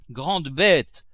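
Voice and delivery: loud, sharp scolding. A narrator's reading. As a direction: bark the insult at the clumsy servant. Master scolds stumbling servant.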